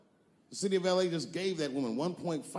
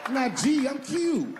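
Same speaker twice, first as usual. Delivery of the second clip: mock prison inmate; 8 semitones higher than usual. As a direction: claim it with defiant swagger.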